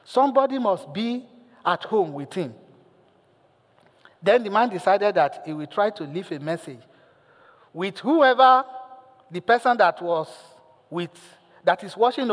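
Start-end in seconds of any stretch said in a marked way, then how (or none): none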